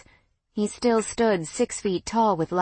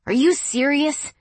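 incredulous; 8 semitones higher, 5 dB louder than usual. Acted. Ex stunned by comparison.

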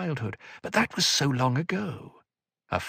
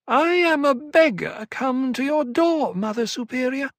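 whining elfin voice; 13 semitones higher, 4 dB louder than usual. A narrator's reading. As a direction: beg like a wheedling elf. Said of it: "in that whining elfin voice".

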